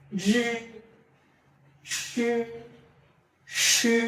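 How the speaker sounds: জি and সে drawn out with a push of air; throaty pinyin sounds. Shown as a demonstration. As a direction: hold জি and সে long, pushed from the throat. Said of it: "এটা খেয়াল করেন".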